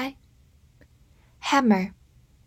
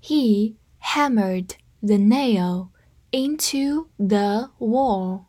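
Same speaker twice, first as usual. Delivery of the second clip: slow and evenly spaced; slow read; much slower than usual. Shown as a demonstration. Teacher reads slowly for students to copy. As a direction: drag each word out for learners. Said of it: "跟着我慢读一遍".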